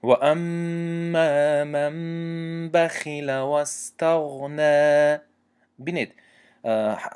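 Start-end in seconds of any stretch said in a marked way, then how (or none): 0.0-5.2 بخل recited very slowly with a thin light خا under kasra; Quran recitation, thin kha. Shown as a demonstration. Teacher reciting the exception with a thin kha. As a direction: chant و اما من بخل و استغنی slowly, خا light. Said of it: "مثال دیگر".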